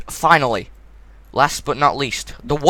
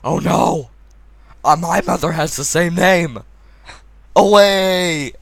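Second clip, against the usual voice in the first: mock-dramatic hero, pitched higher; hammed-up dismay building to a louder cry; Batman; 5 semitones higher, 4 dB louder, slower than usual. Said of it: "then Batman's just like".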